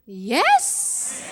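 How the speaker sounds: slow, laid-back drawn-out yes; cool yes. Shown as a demonstration. Preacher showing how to accept grace, cool relaxed yes. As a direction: say yes coolly, unbothered. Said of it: "쿨하게 예스".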